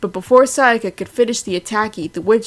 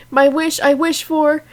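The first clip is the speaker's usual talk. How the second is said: high, loud eager cry; eager exclamation; 8 semitones higher, 5 dB louder than usual. Acted. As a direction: burst out with the wish. Madoka starts her wish.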